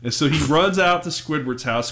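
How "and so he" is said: usual speaking voice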